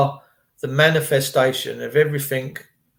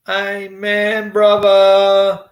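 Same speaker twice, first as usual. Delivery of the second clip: drawn-out, raised, ringing exclamation; mock preacher amen; 5 semitones higher, 5 dB louder, much slower than usual. Responding to God works in mysterious ways, mock-preacher amen. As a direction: hold each word long, building louder.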